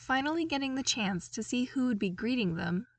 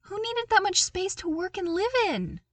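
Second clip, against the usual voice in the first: much higher and louder, disbelieving; incredulous awe; 11 semitones higher, 6 dB louder than usual. A narrator's reading. Luke marvelling at the Emperor's palace, high incredulous disbelief. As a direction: ask it like you can't believe anyone needs this much.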